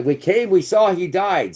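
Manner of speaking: a lightly voiced mocking Hillary Clinton; mocking Hillary quote. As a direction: drag it out like a smug victory line. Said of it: "said, you know, Hillary Clinton".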